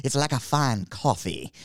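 slow, hammed-up bad Swiss accent; a deliberately terrible Swiss accent, slowly; mock Swiss accent. Mocking Cesaro's promo in a bad Swiss accent. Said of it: "that's not even close to a Swiss accent".

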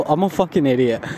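ordinary delivery

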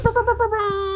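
high rolled brrr; celebratory trill. Pretend. Guest hyping her own unemployment, high playful trill. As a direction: trill it high like a hype horn.

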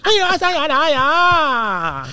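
high, drawn-out complaining wail; mock Korean wailing. Comedian imitating Korean dramas' constant complaining, mock wailing. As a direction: lament everything in long, high, stretched vowels. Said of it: "se están quejando todo el tiempo de algo".

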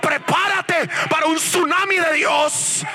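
high, shouted revival preaching; full-force preaching. Real, not acted. Preacher proclaims his transformation to congregation, full-force preaching. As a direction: build to ecstatic cries, stretching the climactic words.

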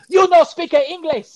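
high, loud, slow mock boss voice in broken English; mocking Vince. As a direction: ham up a clueless old boss talking down. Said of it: "And Vince is like".

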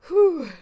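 sharp, loud, high "whew"; exasperated whew. Real, not acted. Driver worked up after blinker rant, loud exasperated whew. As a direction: blow off steam in one loud whoop of breath.

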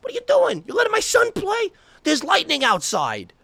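very high mock mother voice; outraged soccer mom. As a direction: imitate a fussing, scandalized mom in falsetto. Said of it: "seven-year-olds whose mothers were taking them to games who are like".

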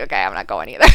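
low, dry, offhand okay; a fan faking indifference; deadpan. Retold or imitated. Deadpan reply to the writer.

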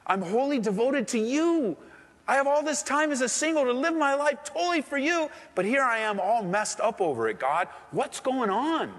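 high, pleading cry to God; pleading prayer. Pretend. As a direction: beg the Lord for answers, voice lifted high.

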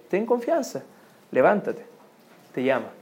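soft, low reassurance with pauses; gently urge, phrase by phrase; soft encouragement. Acted. Quoted crowd encourages the blind man. (ánimo en voz baja, pausado)

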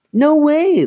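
loud, very high refusal; insistent. A narrator's reading. Lindsay refuses Chris, loudly insistent. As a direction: refuse outright, pitch soaring. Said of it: "Lindsay insisted".

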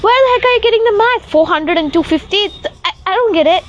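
high, fast incredulous rant; exasperated outburst. Retold or imitated. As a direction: blurt it out, baffled and fed up. Retelling own outraged reply.

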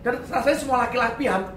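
normal talking voice

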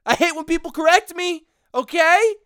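loud, high heated rant. Real, not acted. Venting about being corrected, loud heated rant. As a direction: rant about it as if boiling over. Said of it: "I'm getting too heated".

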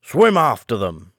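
mild mock scream; understated acted cry. A narrator's reading. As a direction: call it out, short of a real scream. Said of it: "screamed the Griffin".

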